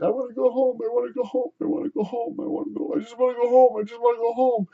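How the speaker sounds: high, soft whimpering plea; mock sobbing, thin high voice; imitated crying. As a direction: imitate a tearful, high-pitched whine. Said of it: "he started crying and said".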